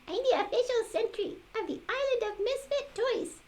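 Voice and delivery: high, quick jack-in-the-box voice; high puppet voice. Acted. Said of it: "said the puppet".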